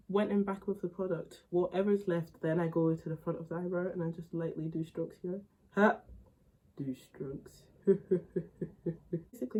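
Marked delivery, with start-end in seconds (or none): 5.8-9.6 laughing. Real, not acted. Laughs at own phrase.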